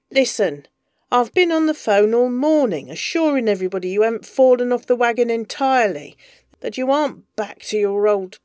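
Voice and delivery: loud, fast, exasperated rant; angry rant. Acted. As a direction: rattle it off in fury. Bees ranting fast on phone.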